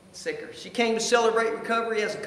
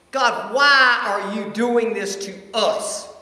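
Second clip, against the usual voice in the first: slow, louder, pleading in anger; angry plea to God; 4 semitones higher, 8 dB louder, slower than usual. Retold or imitated. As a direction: demand an answer from God, drawn out. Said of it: "I was just furious at God".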